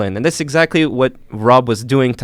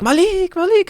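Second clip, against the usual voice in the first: put-on high, sing-song call of kids spotting a friend; kids calling his name. Kids in the police office calling out to Malik, high excited call.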